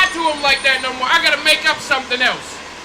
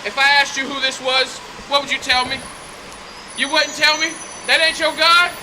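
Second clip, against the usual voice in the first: ordinary delivery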